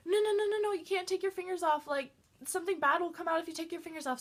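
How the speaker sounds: high, loud, rushed no-no-no; panicked pleading. Retold or imitated. Lily begging her friend to keep fingers on, high and panicked. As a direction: beg her not to let go, alarmed.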